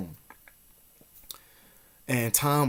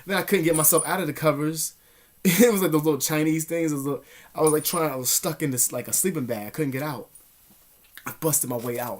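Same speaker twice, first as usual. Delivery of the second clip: normal talking voice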